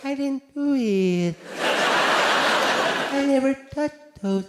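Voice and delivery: [name] voice, very slow. Retold or imitated. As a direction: plead innocence slowly like Michael. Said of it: "What was he gonna say".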